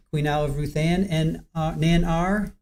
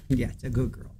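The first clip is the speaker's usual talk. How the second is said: soft praise; 5 semitones lower, 8 dB quieter, faster than usual. Real, not acted. Praising the cat.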